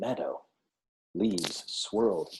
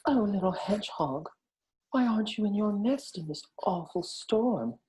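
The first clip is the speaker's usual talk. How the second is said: higher, concerned mother deer; 11 semitones higher than usual. A narrator's reading. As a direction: ask with a mother's worry. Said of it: "she asked".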